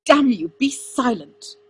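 angry order with heavy, drawn-out emphasis; snarled. A narrator's reading. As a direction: snarl a furious command. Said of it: "Arthur snarled".

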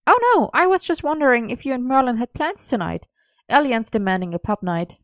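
high start, easing down; Gwen's voice. A narrator's reading. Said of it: "Gwen said".

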